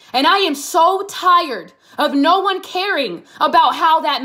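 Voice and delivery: rising impassioned outburst. Real, not acted. Student scolding classmates, building into loud impassioned outburst. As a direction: build from urgent to near shouting. Impassioned plea against campus unkindness.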